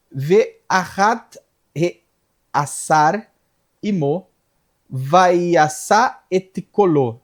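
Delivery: slow, drawn-out Hebrew reading; deliberate, stretched verse reading; scripture recitation. Real, not acted.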